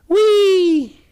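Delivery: high, loud joyful whee; excited shriek of joy; gleeful whee. Pretend. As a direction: squeal like a rollercoaster ride. Price rockets up.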